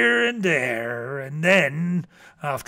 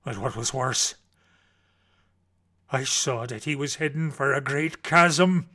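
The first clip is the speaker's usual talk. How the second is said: usual speaking voice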